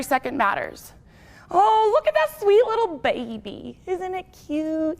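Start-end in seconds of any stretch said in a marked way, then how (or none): 1.5-5.0 put-on cooing high over a baby; baby talk. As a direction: gush like at a baby.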